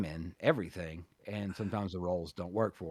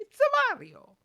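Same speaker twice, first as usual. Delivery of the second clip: much higher, louder put-on Mario voice; Mario impression; 7 dB louder, slower than usual. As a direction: pop out a hammed-up Mario catchphrase. Joking about Mario movie voice.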